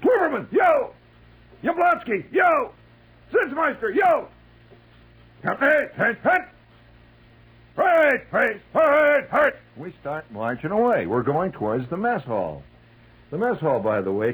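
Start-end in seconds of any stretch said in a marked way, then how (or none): 0.0-4.3 high, loud name and answer; roll call shouts. Retold or imitated. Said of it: "he keeps going on". 5.4-9.6 high, loud barked orders; drill commands. Retold or imitated. Sergeant barking marching orders.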